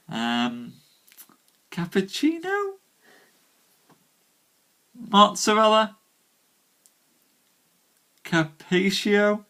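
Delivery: put-on tentatively parade food words in a playful Italian accent; showing off shaky Italian to the chat; mock Italian. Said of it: "[name]'s knowledge of Italian".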